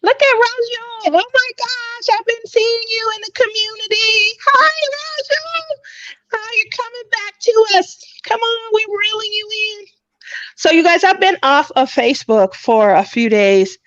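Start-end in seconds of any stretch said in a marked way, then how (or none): 0.0-9.8 very high, squealing hello; excited greeting. Real, not acted. Host hailing a returning community member, high excited squeal. Gushing greeting to arriving viewer.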